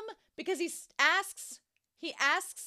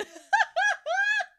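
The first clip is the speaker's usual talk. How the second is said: loud, high giggle; high burst of laughter; 11 dB louder than usual. Real, not acted. Vlogger laughing at herself for predicting it, loud high giggle. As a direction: crack up at yourself.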